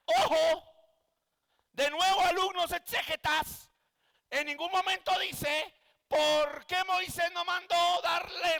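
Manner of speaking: loud, high fervent preaching; preaching at full force. Acted. Pastor urges his student exegetes, loud and fervent. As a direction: drive the point home to the class.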